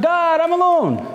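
acted plea, pitched well above talking voice; crying out to God. Preacher voices a doubter calling to God, crying out. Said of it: "we cry out to God".

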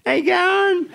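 high-pitched mock greeting; imitated casual greeting. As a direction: call it out high, mimicking him mid-ride. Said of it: "he looks over and he goes".

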